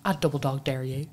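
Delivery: imitated Derry dare; Derry accent. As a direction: drop into a mock Derry accent. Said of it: "when you make that accent".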